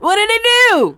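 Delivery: very high, loud mock disbelief; squeaky mock outrage. Cohost echoes the girl's question, squeaky and loud. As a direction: squeal the question in fake shock.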